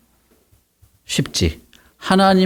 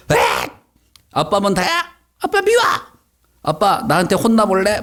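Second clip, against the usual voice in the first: much higher, shrill tantrum peaking on 아빠 미워; bratty outburst; 7 semitones higher than usual. Acted. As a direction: throw a kid's tantrum at dad. Child talks back to dad.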